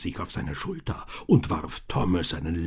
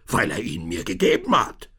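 louder, higher confession building to a roar; yelling ever louder; 5 semitones higher, 9 dB louder than usual. A narrator's reading. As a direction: push each phrase harder. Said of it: "brüllte immer lauter".

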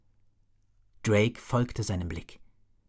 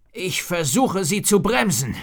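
higher, louder wizard's cry; wizard shouting; 8 semitones higher, 7 dB louder than usual. A narrator's reading. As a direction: call out the spell's purpose urgently. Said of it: "schrie der Zauberer".

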